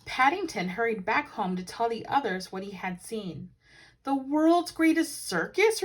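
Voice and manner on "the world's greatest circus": doubting surprise. A narrator's reading. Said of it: "repeated Jonathan".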